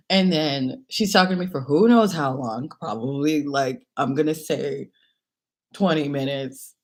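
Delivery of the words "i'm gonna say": ordinary delivery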